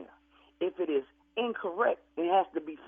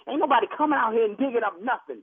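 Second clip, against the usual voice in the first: ordinary talk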